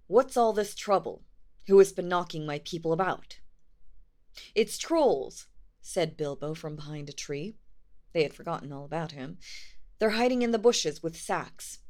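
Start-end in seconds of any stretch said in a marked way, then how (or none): 0.1-3.4 loud, commanding dwarf king; stern shout. Acted. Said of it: "He stood outside in the shadows". 4.5-5.5 loud, high warning; urgent call. Acted. Bilbo warns from tree. 10.0-11.8 loud, high warning; urgent call. Acted. Bilbo warns from tree.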